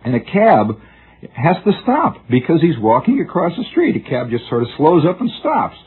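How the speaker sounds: ordinary talk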